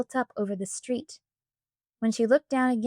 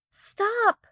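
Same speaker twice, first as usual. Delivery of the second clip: sharp, much higher cry of stop; a higher, urgent shout at the dog as it bolts; 11 semitones higher than usual. A narrator's reading. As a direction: yell it after the running dog. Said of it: "shouted Samantha".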